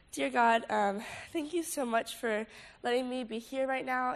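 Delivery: prayer. Acted. Leads a prayer.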